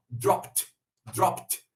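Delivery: clipped final T on "dropped"; "dropped" ending in a T sound. Shown as a demonstration. Teacher drilling Brazilian students on "dropped" with a T ending. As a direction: say "dropped" as drop-t, no extra syllable. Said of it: "vão terminar com som de T".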